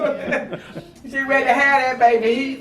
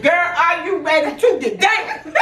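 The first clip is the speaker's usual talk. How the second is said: slow, higher-pitched teasing holler; mock yell; 7 semitones higher than usual. Mother teasing daughter through contractions, slow mock yell. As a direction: holler it playfully, stretched out and laughing. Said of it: "So I started yelling at her".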